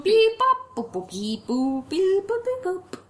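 beeping like a little toy robot. Pretend. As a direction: answer in beeps and boops. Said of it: "I would like to answer".

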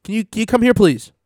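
high, put-on polite customs officer voice. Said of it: "They go".

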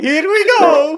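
high, loud cartoon Italian catchphrase; Super Mario. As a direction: whoop it out like the game plumber. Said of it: "sound like Super Mario".

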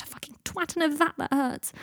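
high, wailing grumble; pained rant. A narrator's reading. Draco curses at Harry over his headache, pained rant. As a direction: whine it out in aggrieved pain.

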